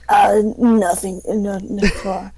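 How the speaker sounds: put-on slow, hesitant old man; lightly voiced anxious old man. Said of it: "old person voice".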